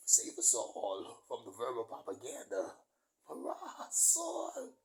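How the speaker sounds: exclaimed prayer, jumping very high at the end; theatrical cry to heaven; mock plea for salvation.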